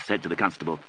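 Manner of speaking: ordinary delivery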